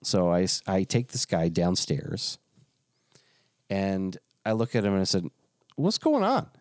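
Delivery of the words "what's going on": a high, startled What's going on. Shown as a demonstration. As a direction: ask it high and sharp. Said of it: "And I said it just like that".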